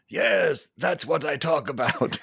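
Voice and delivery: high, slow, hammed-up cartoon Russian accent. Fan quoting Red Guardian's cheer in a cartoon Russian accent. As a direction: belt it like a proud cartoon Russian. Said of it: "cartoon Russian with bad grammar".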